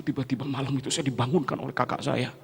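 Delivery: normal talking voice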